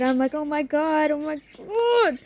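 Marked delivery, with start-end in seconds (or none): 0.3-1.1 excited. Retold or imitated. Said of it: "'cause I'm excited". 1.1-2.2 excited. Retold or imitated. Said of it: "'cause I'm excited".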